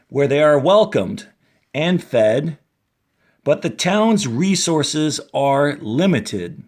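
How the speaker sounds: clear, lifted read-aloud voice; reading to children. A narrator's reading. Teacher reading the book intro to kids, lightly lifted storytelling voice. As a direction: read it out for young listeners.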